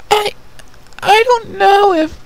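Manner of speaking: put-on very high, halting little-girl voice; timid princess. Youngest princess answering her mother, high and nervous. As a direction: stammer out a doubt.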